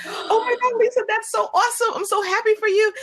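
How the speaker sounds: high, excited squeal; excited scream. Retold or imitated. Retelling her reaction to a friend, excited high squeal. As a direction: squeal with joy for your friend. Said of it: "I screamed so loud".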